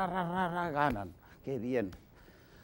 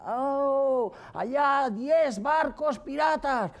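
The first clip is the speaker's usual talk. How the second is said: much higher, loud, slow alarm; panicked lookout cry; 11 semitones higher, 5 dB louder, much slower than usual. Acted. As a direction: scream the sighting in alarm. Lookout cries ten ships. (el vigía grita aterrado, muy agudo y fuerte)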